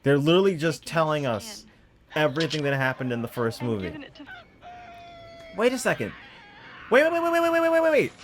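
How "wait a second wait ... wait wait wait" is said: high, rapid string of waits; excited realization. Real, not acted. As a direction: stop everything, it just clicked. Sudden realization about Larry.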